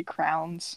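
"crowns" as one syllable, for crayons; mispronounced crayons. Retold or imitated. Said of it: "instead of saying crayons".

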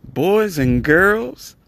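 high, sing-song showman greeting; booming sing song welcome. Acted. Podcast host's showman opening welcome.

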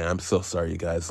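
quiet sorry; soft apology. Real, not acted. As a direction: murmur the apology. Apology aside.

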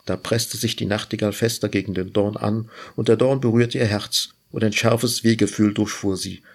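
plain reading voice

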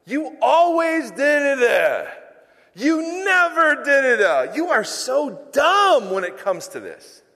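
a spouse criticising their partner's character, loud and slow; mock criticism. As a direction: hammer the spouse with blame, slowly. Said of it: "the constant criticism of the other person's character".

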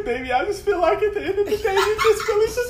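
squeaky laughing impression of his girlfriend; high mocking girlfriend voice. Boyfriend role-plays his girlfriend mid-argument, high laughing voice. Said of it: "this is how we argue. I'm you".

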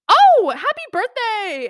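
high, loud, drawn-out congratulations; hammed-up excited exclamation; mock delighted surprise. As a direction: imitate a gushing birthday greeting. Officer spots birthday on license.

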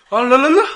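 loud high laughing trill; motorbike imitation. Shown as a demonstration. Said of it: "模仿那个摩托车". (边笑边模仿摩托车声)

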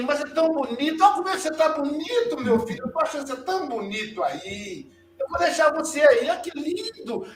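high, sing-song mock praise from grandma; grandma sweetly mocking the grandson she locked in the henhouse; teasing grandma. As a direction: coo at the boy as if he's lovely in the henhouse.

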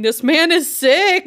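high, loud, slow mock scream; hammed-up shout of alarm; retold scream. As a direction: scream it like a man losing it. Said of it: "He was screaming".

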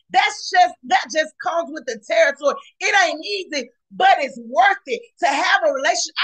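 fiery preacher calling out to the congregation; preaching at full force. Acted. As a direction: preach it out like the spirit is moving. Preaching to podcast listeners.

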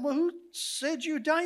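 put-on high, incredulous reply to the child; puzzled question. Ron questions the boy.

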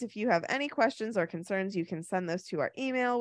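usual speaking voice

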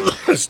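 harsh hacking cough; coughing fit. Real, not acted. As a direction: cough hard into the mic. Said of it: "Sorry, y'all heard".

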